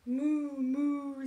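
loud lowing moo; cow moo. Acted. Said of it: "said the cow".

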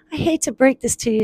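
lightly acted Mitten line; reluctant character voice. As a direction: break it to a friend delicately. Mitten breaks bad news.

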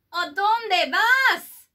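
high, loud Spanish call, slow. A narrator's reading. Skipito calling to his amigos on the croc, loud and high. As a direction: call out across the river. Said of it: "called out Skipito".

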